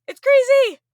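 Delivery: high, loud squeal of delight; excited shriek. Real, not acted. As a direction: burst out in disbelief. Host overwhelmed by story.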